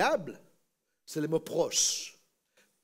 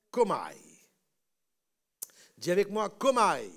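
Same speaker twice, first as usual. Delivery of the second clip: normal talking voice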